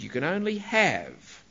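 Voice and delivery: normal talking voice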